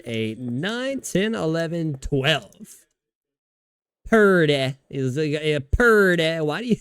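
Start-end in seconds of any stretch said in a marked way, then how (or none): 4.1-4.7 Purdy with a long PUR and short "deh"; mock drawn-out Purdy; Cam Newton. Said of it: "saying his name like that". 5.3-6.4 drawn-out Purdy, ending on "deh"; slow imitation leaning on Purdy; Cam Newton. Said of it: "saying his name like that".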